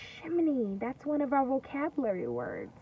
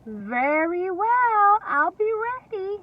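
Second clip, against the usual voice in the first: little pig; 7 semitones higher, 5 dB louder, slower than usual. Acted. Pig replying to the wolf.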